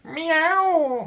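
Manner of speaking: very high mewing cat; cat meow. Acted. Story cats hiss a warning at Harriet, high meow. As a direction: meow like a little kitten.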